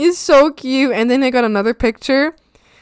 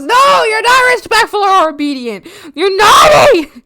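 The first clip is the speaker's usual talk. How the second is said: loud, higher mock telling-off; mock scolding; 8 semitones higher, 7 dB louder than usual. Teasing a viewer's naughty frog with a loud mock scold.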